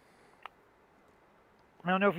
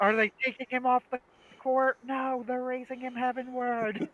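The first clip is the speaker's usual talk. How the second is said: high, astonished play-by-play; mock sports commentator; 10 semitones higher, 4 dB louder than usual. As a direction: call it like a stunned match announcer. Acts sketch's sports commentators.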